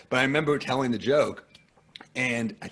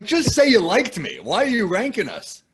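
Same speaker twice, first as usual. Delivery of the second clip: exasperated protest; 9 semitones higher, 5 dB louder than usual. Retold or imitated. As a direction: plead in mock outrage. Acts out protest at being ranked.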